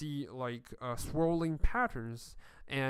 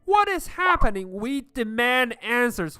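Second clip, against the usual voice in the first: high, loud demanding yell; furious crowd demanding answers; angry crowd shout; 8 semitones higher, 8 dB louder than usual. Acted.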